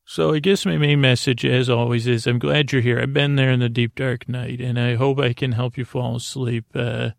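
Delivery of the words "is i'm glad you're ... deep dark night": ordinary delivery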